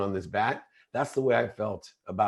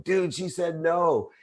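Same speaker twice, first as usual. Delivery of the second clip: mock schoolboy yell, higher and slow; a kid calling out to the whole camp, higher and drawn out; retold yell; 8 semitones higher, slower than usual. Boy calling out the rejection to everyone, mock yell. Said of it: "he yelled out in front of everyone".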